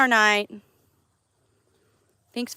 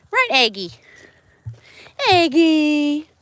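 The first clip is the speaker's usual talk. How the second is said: calling out; 6 semitones higher, 8 dB louder, slower than usual. Real, not acted. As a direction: call the name out loud. Calling animal's name.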